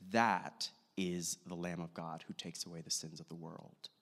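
low, soft retold declaration; quiet, low-pitched quote; hushed awe. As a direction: say it low and hushed, as if watching him pass. Retold John gazing at passing Jesus.

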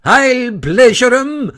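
high, loud, vengeful threat; drawn-out indignant war whoop; yelling. A narrator's reading. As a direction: yell it while charging at the enemy. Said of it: "he yelled".

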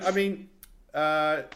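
ordinary talk

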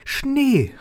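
put-on joyful child's yell; excited shout. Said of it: "rief Pitt".

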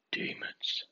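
breathy eerie whisper of the word demons; spooky whisper. Acted. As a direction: whisper it like a campfire ghost story. Spooky announcement of ghost topic.

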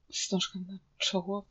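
very faint, dazed echo; stunned murmur. A narrator's reading. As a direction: barely get it out, in shock. Stunned, blood rushing to head. (oszołomiony, ledwo słyszalny szept)

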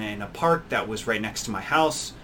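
ordinary talk, nothing marked

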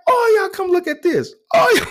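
very high, hollered summons to everyone; mock calling out. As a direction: imitate a cashier rounding up the whole store. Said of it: "she calls her out".

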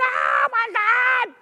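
a very high, loud terrified shout, slow on the last words; panicked disciples screaming in the storm; screaming in terror. Retold or imitated. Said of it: "they start just screaming".

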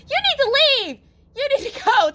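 high, loud mock of a yelling mom; imitating a shrill order to leave; mocked yelling mother. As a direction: shout it high like an angry mother. Mocking his mother yelling.

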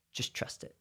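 near-whisper, soft and intimate; hushed reassurance. Acted. Jesus to his followers before he is taken, hushed reassurance. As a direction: let it drop to a gentle whisper.

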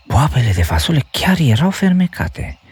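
put-on high boyish voice, amazed; boy's thought. A narrator's reading. Jack thinking to himself in wonder. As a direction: voice the boy realising the magic. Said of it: "se gândi băiatul".

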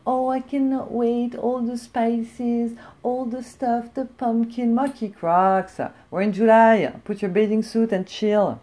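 high, slow, dreamy mock gushing over autumn; slow, high, swooning mockery of autumn lovers; gushing fall fan. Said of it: "And they're like".

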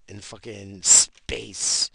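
loud and slow, punching every word; slow, loud outburst; exasperated shout. Real, not acted. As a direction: spell it out loudly to a fool. Ranting about nautical miles.

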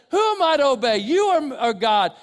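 loud, high, defiant challenge; defiant retort. Retold or imitated. Preacher voicing the apostles, defiant and loud. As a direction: fling the question back at them.

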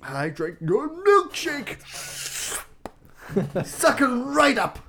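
slow, high, hammed-up oil baron voice; Daniel Day-Lewis impression. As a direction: drag out the milkshake line, mock menacing. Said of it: "Daniel Day-Lewis".

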